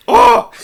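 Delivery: loud, high "oh" of frustration; exasperated cry. Real, not acted. Host capping the slang rant, exasperated cry. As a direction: burst out in exasperation.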